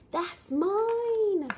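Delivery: high, loud and bouncy title read; fun, exciting reading voice. Shown as a demonstration. Parent reading a picture book title to a toddler, excited. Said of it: "always use, like, a fun, exciting voice".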